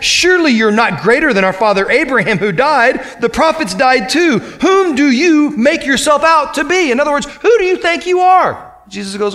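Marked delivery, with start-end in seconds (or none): 0.0-6.9 rising higher into a mock-indignant challenge; acted indignant challenge. 7.4-8.7 high-pitched, mock-outraged demand; acted affronted challenge. Crowd demanding Jesus explain who he is, mock outrage.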